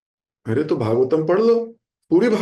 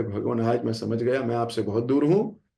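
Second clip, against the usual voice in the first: lower, softer, humble plea; humble folded hands; 7 semitones lower, 5 dB quieter than usual. Retold or imitated. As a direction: speak with joined hands, meek and sincere. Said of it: "हाथ जोड़ के खड़े हो गए".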